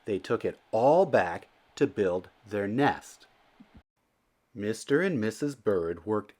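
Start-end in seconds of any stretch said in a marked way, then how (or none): none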